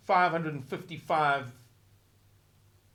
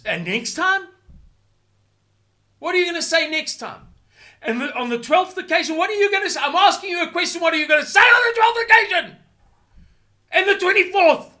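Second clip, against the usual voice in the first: rising from sharp to a far higher, louder furious shout, faster; angry interrogation; 13 semitones higher, 10 dB louder, faster than usual. Acted. Speaker berating an imagined leader, a building furious shout. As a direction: badger him harder with each question until yelling. Hectoring imagined official, escalating.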